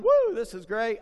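loud, high playful cheer; mock gleeful whoop. Preacher voicing Jesus having fun on the waves, gleeful whoop. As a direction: whoop like he's surfing the waves.

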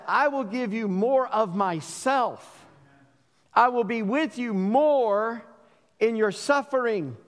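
slow, gentle voice of God; lightly voiced God, slow and reassuring; tender promise. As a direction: promise it slowly, like a comfort. Preacher voices God's promise.

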